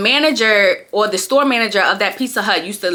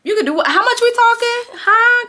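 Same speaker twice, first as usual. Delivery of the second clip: high, excited how much we talking; eager interest; 14 semitones higher than usual. Retold or imitated. Reacting to the manager's pay offer, high eager perk-up. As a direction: perk right up at the money. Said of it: "I'm like".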